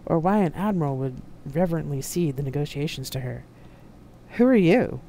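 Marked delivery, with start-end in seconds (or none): 4.4-5.0 loud, higher, rude challenge; rude demand. A narrator's reading. Admiral challenges the unknown woman, rudely. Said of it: "he asked rudely".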